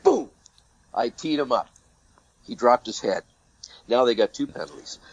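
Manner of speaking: usual speaking voice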